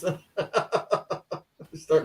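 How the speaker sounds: laughing. Real, not acted. Joking about friend.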